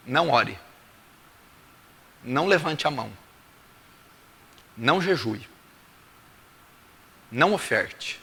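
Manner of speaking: low, quiet commands with long pauses; Jesus. Acted. Preacher voicing Jesus refusing worship, quiet and measured. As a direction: lay down each prohibition softly and separately. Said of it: "sabe o que Jesus tá dizendo?".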